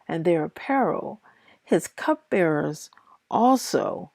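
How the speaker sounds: steady, measured Bible reading aloud; reading scripture aloud to listeners. Acted. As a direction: read the verses out with even, careful pacing. Reads Second Chronicles aloud.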